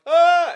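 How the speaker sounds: high, loud cartoon yelp of fright; a hammed-up startled shriek; mock scream. The boy yelps as the truck roars past, mock scream. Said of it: "And he's like".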